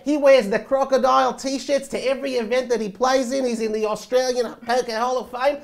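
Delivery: high-pitched Australian impression; excitable mock-Australian voice; Australian accent. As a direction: keep up a hammed-up Aussie accent, rising to a loud cry. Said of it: "That's a pretty good accent".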